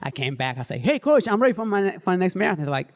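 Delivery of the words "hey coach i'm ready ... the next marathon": higher, louder, eager pitch to the coach; retold burst of enthusiasm; eager announcement. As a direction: call out keenly, ready to go.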